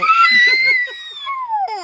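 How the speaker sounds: loud sustained laugh; hearty laughing sign-off; long high laugh. Real, not acted. Long laugh ending call.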